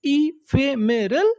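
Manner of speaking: "ephemeral" slowly in pieces, lifting at the end; broken into syllables. Shown as a demonstration. Teacher prompting learners to recall the word, syllable by syllable. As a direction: stretch "ephemeral" out syllable after syllable. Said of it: "टुकड़ों में तोड़-तोड़ के पढ़ना है".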